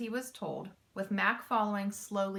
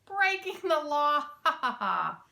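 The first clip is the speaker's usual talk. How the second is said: louder, scoffing laugh at the idea; mocking laugh; 6 semitones higher, 4 dB louder than usual. A narrator's reading. Louis scoffs at Mac's warning, mocking laugh. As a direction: laugh it off as absurd. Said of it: "Louis laughed".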